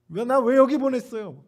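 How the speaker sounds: a preacher acting out Jonah's angry complaint; indignant protest. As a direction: protest hotly at God, voice up. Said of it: "그러면서 따지는 거죠".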